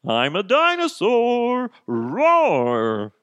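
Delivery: loud, high, stretched-out roar; hammed-up dinosaur bellow; pretend dinosaur. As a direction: roar the words slowly like a dinosaur. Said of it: "is gonna be this".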